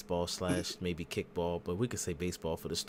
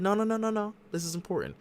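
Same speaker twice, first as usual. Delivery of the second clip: much higher, rapid string of no's; excited insisting; 13 semitones higher, 3 dB louder than usual. Retold or imitated. Pearce cutting Heyman off, high excited protest. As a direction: pitch it way up, frantic and insistent.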